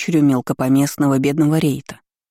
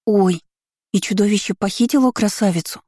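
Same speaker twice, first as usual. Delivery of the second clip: put-on high surprised child gasp; gasping girl; 5 semitones higher than usual. A narrator's reading. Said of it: "ахнула девочка". (испуганный детский ах)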